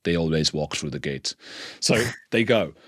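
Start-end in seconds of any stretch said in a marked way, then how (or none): 0.0-1.3 put-on low, heavy Soviet accent; deadpan Russian guard accent. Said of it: "like, really, like, Soviet accent".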